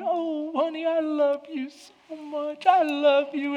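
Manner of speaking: high, slow, syrupy mock wife; gushing wife. Wife gushing over her husband, in a high cooing voice. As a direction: coo it like a clingy wife. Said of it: "most wives make the mistake of going".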